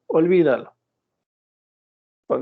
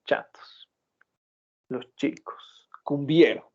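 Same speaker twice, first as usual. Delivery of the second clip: imitating doctor Castillo; much slower than usual. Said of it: "Orgulloso el doctor Castillo".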